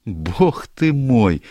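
theatrical slow exclaim; exclamation. Acted. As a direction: draw out the exclamation in disbelief. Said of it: "воскликнула Барбара".

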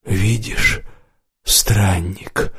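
hoarse, worn-out voice; hoarse, strained. A narrator's reading. Mamed warns the wanderer, hoarse and strained. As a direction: force it out through a strained throat. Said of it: "голос Мамеда был хриплый и надсаженный".

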